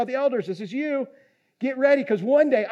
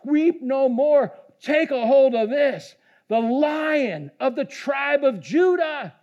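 slow, raised, emphatic declaration; commanding elder's proclamation; 4 semitones higher, 4 dB louder, slower than usual. Retold or imitated. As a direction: proclaim it slowly, high and strong. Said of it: "He said".

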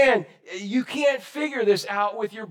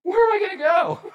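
much higher, loud outburst; exclaimed Peter; 13 semitones higher, 4 dB louder than usual. Retold or imitated. Peter answering Jesus, loud high outburst. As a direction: blurt it out like Peter. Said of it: "What did Peter say?".

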